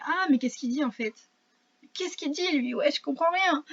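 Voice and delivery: high-pitched, mock-puzzled quote; Anglais déconcertés. Joue des Anglais qui ne comprennent pas. (incompréhension jouée, voix plus aiguë)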